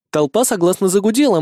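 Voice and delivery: plain reading voice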